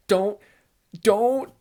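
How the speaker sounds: high, loud, desperate "don't"; pleading from the couch, controller gripped; anxious pleading. Retold or imitated. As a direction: beg the screen not to let it happen. Begging the game character to act.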